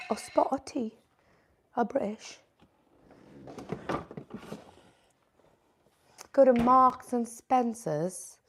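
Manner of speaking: jokey British tea-time voice; playfully faked British; mock British accent. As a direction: try on a British accent, not quite landing it. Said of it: "Everyone always roasts my British accent".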